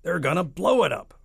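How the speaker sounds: loud, high army chief's outcry; crying out. A narrator's reading. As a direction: cry the alarm in a put-on voice. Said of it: "cried the chief of the army".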